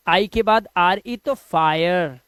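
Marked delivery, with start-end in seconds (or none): 1.3-2.2 फायर stretched as "faa-yer"; drawn-out ire sound. Shown as a demonstration. Teacher demonstrating the i-r-e rule, फायर slowly drawn out. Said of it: "इसका उच्चारण हो जाएगा आय".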